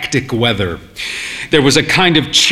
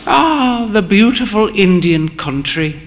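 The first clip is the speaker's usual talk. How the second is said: sighed; 6 semitones higher than usual. A narrator's reading. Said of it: "she sighed".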